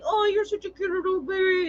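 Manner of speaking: put-on high-pitched baby talk to a cat; squeaky-high, doting coo; cooing pet talk. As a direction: imitate gushing over an adored pet. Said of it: "in that tone of voice".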